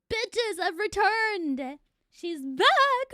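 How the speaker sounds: slow, loud, triumphant; excited. Real, not acted. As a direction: savour the comeback. Stream returns after crash.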